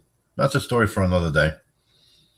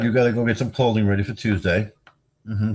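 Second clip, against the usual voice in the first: normal talking voice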